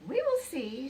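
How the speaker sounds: high, loud hen voice for Ruby; chicken voice. A narrator's reading. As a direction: squawk it out like a cheerful hen. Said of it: "said Ruby".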